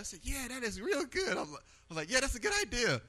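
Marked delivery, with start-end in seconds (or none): 0.2-1.4 high-pitched excited agreement, re-enacted; real excited. Man retelling his reaction to friend's outreach, high and excited. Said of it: "I getting real excited". 2.1-3.0 re-enacted excitement, pitched high; real excited. Man agreeing with his friend's idea, high excited retelling. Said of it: "I getting real excited".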